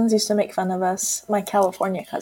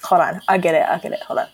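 ordinary talk, nothing marked

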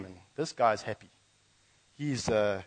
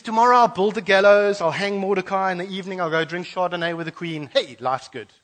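smug scheming; 6 semitones higher, 8 dB louder than usual. Acted. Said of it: "And he thinks".

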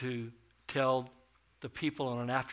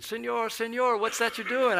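put-on much higher, louder voice of an excited little boy; 10 semitones higher, 8 dB louder than usual. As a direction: call out like a curious kid. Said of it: "a little boy saw me, and he said".

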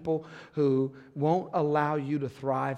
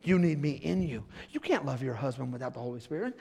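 normal talking voice

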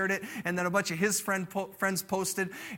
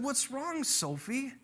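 gentle concern; 4 semitones higher, 6 dB quieter, slower than usual. Retold or imitated. Father gently asks sad daughter.

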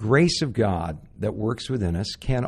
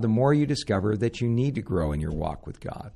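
normal talking voice

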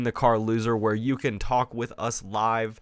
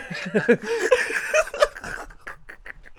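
high laugh. Real, not acted. Laughs at own bit.

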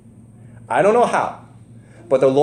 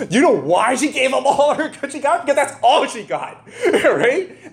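laughing bitterly; 9 semitones higher than usual. Real, not acted. Bitter laugh at widow's plight.

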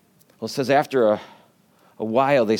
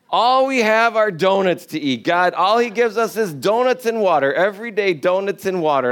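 raised, whiny grumble about donuts; mock whiny complaint; 6 semitones higher than usual. Israelites grumbling to God about manna, hammed-up whine.

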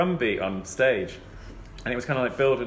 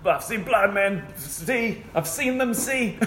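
high, slow hammed-up preacher; drawn-out preaching voice, much higher; hammed-up healing preacher; mock preacher; 8 semitones higher, much slower than usual. Imitating preacher on stage.

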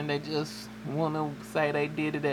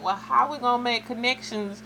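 higher, rising protest as the daughter; mimicking daughter; 7 semitones higher than usual. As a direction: play the daughter, indignant.

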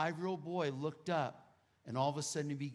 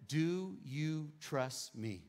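very slow, word by word; slow, deliberate question; much slower than usual. Retold or imitated. God asks a struggling father for trust, slow and deliberate. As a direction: let each word land slowly. God's solemn question in retelling.

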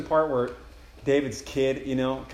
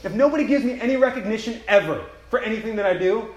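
louder, overjoyed thanks to God; excited thanks; 9 semitones higher, 7 dB louder than usual. Acted. Believer thanking God for a place in his house, overjoyed. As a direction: gush with grateful excitement. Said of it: "I feel so excited".

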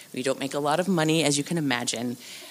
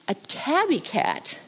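ordinary talk

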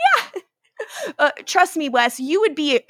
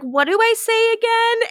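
high, airy mock-clueless voice; mock clueless Liv, high; 11 semitones higher than usual. Podcaster mocking Liv forgetting the spell, high and airy. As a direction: play her as lost, chirping it up high.

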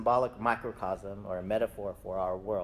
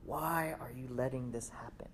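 hushed, questioning prayer; soft plea; 8 dB quieter than usual. Acted. Believer asks God about conflict, softly pleading. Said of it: "we might ask God".

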